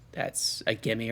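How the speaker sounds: quiet, slow mutter to himself; muttered aside. Real, not acted. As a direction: say it under your breath.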